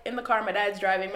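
ordinary talk

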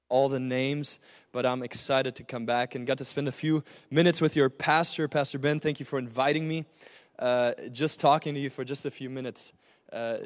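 ordinary talk, nothing marked

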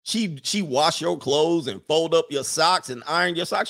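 ordinary talk

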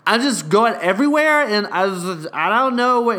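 hammed-up frantic drunk coworker; frazzled drunk coworker. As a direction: mimic her rushed, overwhelmed rant.

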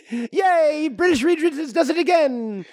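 mock British cheer. British Reed Richards celebrates.

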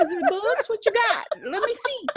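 high, loud mock-innocent excitement; naive eagerness. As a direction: pipe up like a clueless, eager bookworm. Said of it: "The one who would be like".

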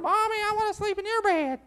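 put-on very high-pitched kid voice; whining little child. Child running into parents' room at night, high whine. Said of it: "When they come running in your room".

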